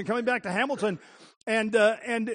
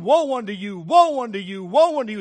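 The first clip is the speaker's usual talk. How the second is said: woe rising louder each time; condemning woe; 5 dB louder than usual. Acted. Jesus condemning the scribes and Pharisees, rising loud denunciation. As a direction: pronounce judgment, building in force. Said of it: "he's condemning them and saying".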